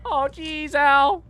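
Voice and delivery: high-pitched mock cry; mimicking the companion. Player echoing "jeez, Al" from the game, mimicking.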